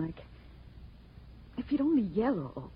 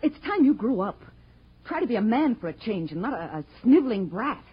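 scolding; 4 semitones higher, 8 dB louder than usual. Acted. Wife scolds Danny in quarrel.

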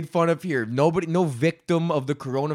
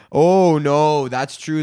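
usual speaking voice

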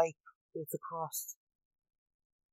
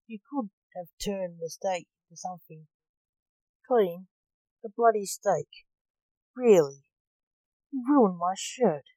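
building to a loud, indignant outburst; screamed complaint; 8 dB louder than usual. A narrator's reading. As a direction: start measured, then punch each phrase louder. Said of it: "screamed Blake".

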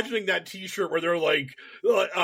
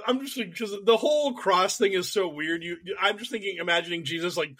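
normal talking voice

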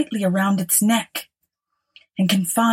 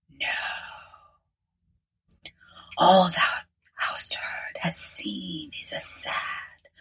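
slow, eerie spectral whisper; a ghost foretelling doom, slowly; hollow ghostly whisper; 6 dB quieter than usual. Acted. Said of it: "whispered a hollow voice in a deep, mysterious tone".

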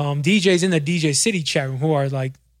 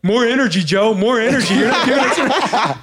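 louder, much higher pushy coaching; imitating Mickey egging on Joe for more energy; mock coach; 7 semitones higher, 5 dB louder than usual. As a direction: demand more energy with a laugh. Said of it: "Mickey's like".

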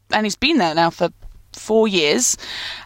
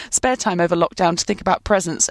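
usual speaking voice

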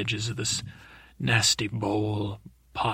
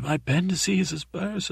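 higher-pitched, put-on wail of pain; mock wail; 7 semitones higher than usual. Boy feigning a burst appendix, mock wailing. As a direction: cry it out like a child faking agony. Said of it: "I started wailing and wailing".

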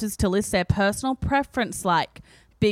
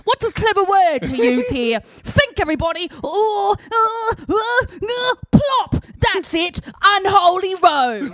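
much higher, louder put-on UK reading voice; 9 semitones higher, 5 dB louder, slower than usual. Reading the magazine's bracketed aside to friends in a higher, louder put-on UK voice. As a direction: read it like a dithering British writer. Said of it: "before she starts talking the U K".

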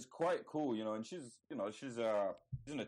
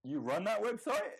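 surprised question; 10 semitones higher, 3 dB louder than usual. Retold or imitated. Quoting the filmmaker's challenge.

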